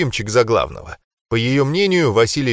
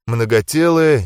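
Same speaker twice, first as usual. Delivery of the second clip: usual speaking voice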